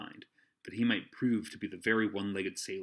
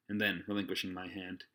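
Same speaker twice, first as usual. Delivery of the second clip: ordinary delivery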